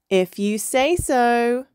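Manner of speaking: slow, cocky grin in the voice; grinning. A narrator's reading. Boy dolphin accepting his cousin's wrestling challenge, grinning. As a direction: draw it out with a grin, pitch lifted. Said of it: "grinned little Hector".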